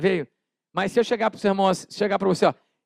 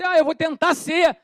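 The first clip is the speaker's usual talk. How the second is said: mock eager believer; 7 semitones higher than usual. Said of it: "Você".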